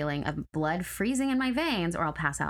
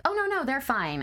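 high, whiny mock of careless owners; mocking dismissive owner; 9 semitones higher than usual. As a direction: parody their breezy dismissal. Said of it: "people be like".

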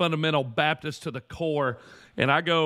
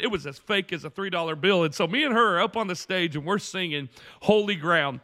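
normal talking voice

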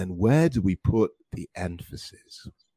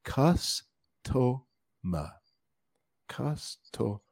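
"Customer" split slowly as CUS, to, mer with pauses; very slow, teacherly "Cus... to... mer"; syllable by syllable; 2 semitones higher, 6 dB quieter, much slower than usual. Shown as a demonstration. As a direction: model "Customer" in three separated beats. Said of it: "break customer down into three".

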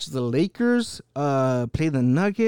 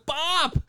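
pop sound; 10 semitones higher than usual. Retold or imitated. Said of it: "and goes".

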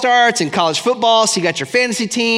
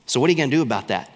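blunt comeback; 6 semitones lower, much faster than usual. Shown as a demonstration. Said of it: "say this".

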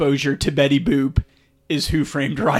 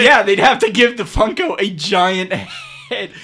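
laughing shout; 7 semitones higher, 5 dB louder than usual. Real, not acted.

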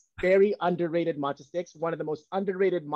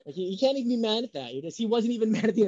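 ordinary talk

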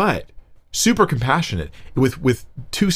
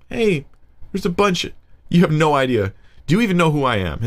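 blunt challenge; 6 semitones higher than usual. Pretend. Jesus' blunt words paraphrased.

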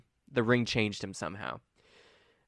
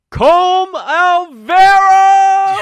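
huge high drawn-out proclamation; triumphant shout; 19 dB louder, much slower than usual. Acted. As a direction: bellow the winner's name to the crowd. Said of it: "and it's a red ring".

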